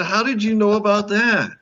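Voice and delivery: very high incredulous question; retold amazement. Guest to the show boss, amazed he knows. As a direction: squeal it in comic disbelief.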